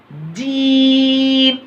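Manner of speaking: जीम with the ee drawn out very long on a high note; long-stretched letter. Shown as a demonstration. Teacher showing how to pull the letter long, जीम held high. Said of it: "लंबा खींचना".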